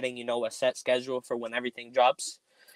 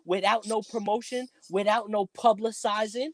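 high-pitched put-on promo pitch; Suge Knight; 7 semitones higher than usual. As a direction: call out like a record boss at an awards show. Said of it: "let me get my Suge, Suge Knight, uh, voice on".

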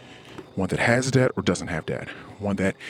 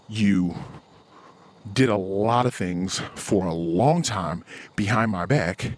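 ordinary delivery

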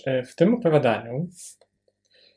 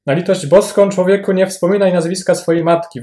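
higher, louder panicked yell; 6 semitones higher, 8 dB louder than usual. Acted. Captain yells at Rose not to name her mother, panicked yell. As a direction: cry it out as if scandalised. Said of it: "wrzasnął, drżąc jak osika".

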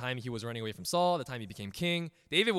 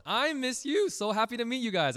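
sugary sweet teacher; 13 semitones higher, 5 dB louder than usual. Retold or imitated. Teacher greeting her new class, high and sugary. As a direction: gush like a cheery first-day teacher. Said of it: "teacher's always happy".

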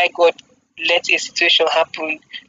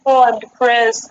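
mock dramatic; 5 semitones higher than usual. Mocking a casual journal entry.

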